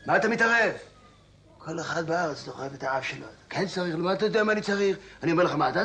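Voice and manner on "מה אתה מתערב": louder, higher, indignant repeat; angry rebuke. Acted. Patient to a meddling stranger, angrily escalating. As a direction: raise your voice at the meddler.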